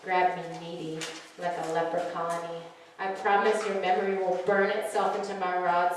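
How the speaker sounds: soft, low spoken-word delivery; hushed, sensual poetry reading; poem reading. Acted. Reads her poem at open mic.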